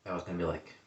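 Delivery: low, quiet letdown; deflated, muttering the disappointment; disappointed. Real, not acted. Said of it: "that's disappointing".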